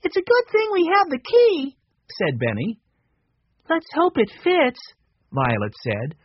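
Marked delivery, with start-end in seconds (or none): 0.0-1.8 put-on high, light little boy voice; a small boy musing aloud, pitched up; young Benny. A narrator's reading. Narrator voicing young Benny. 3.7-4.8 put-on a girl wishing the key works; lightly voiced hopeful girl. A narrator's reading. Narrator voicing Violet.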